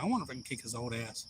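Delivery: low, quick, under-the-breath aside; inner thought. Retold or imitated. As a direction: drop it low and soft, like a private thought. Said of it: "I was thinking in my head".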